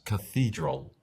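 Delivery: cathedral said slowly, /kaθidɾol/ with th and stress on the middle; English pronunciation. Shown as a demonstration. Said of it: "En inglés es".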